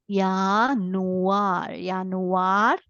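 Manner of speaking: Yanuar with a Y sound and open final a; German Januar. Shown as a demonstration. Teacher repeating German month for learners. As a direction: model Yanuar twice, Y for J. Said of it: "I read it as Yanuar".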